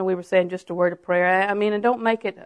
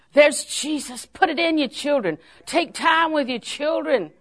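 high-pitched, heartfelt exhortation; impassioned preaching; 7 semitones higher, slower than usual. Real, not acted. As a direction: plead with the congregation in a raised pitch.

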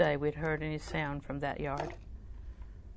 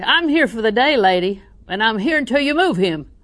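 higher, much louder, demanding yell; mock shout; 5 semitones higher, 12 dB louder than usual. Shown as a demonstration. To the desk lady, the yell she did not use, a demanding shout. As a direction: show the shout she never used. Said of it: "I don't ever shout".

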